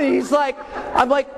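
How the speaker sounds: ordinary talk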